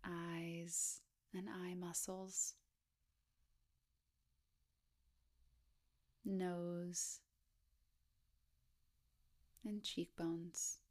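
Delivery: hushed, even body-scan voice with long pauses; guided meditation. Acted. Guide inviting listeners to scan down the body, guided meditation. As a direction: gently lead a meditation, unhurried, leaving space between phrases.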